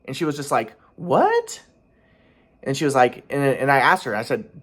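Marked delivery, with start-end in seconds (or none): 0.9-1.6 squeaky high astonished question; incredulous one-word yelp, very high; mimicked stunned disbelief. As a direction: imitate her shock, shooting way up. Mimics her stunned reply.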